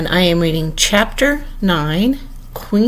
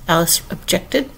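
ordinary delivery

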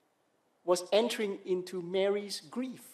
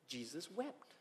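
very soft, hushed reading; hushed, reverent scripture verse; solemn hush; 4 semitones lower, 19 dB quieter, slower than usual. Real, not acted. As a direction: let it drop almost to nothing. Solemn climax of the Bible reading.

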